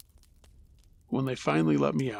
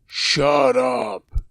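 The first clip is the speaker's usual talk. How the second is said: narrated yell, louder and forceful; raised, commanding storyteller's yell; deep-voiced yell; 4 dB louder than usual. A narrator's reading. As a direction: shout it out like a man telling off a barking dog. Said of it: "I heard a deep voice yell".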